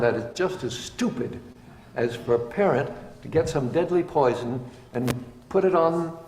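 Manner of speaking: ordinary delivery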